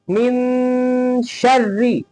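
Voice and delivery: very slow "मिन शर्री", the n held as a nasal hum; ghunna. Shown as a demonstration. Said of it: "ऐसे गुन्ना करके".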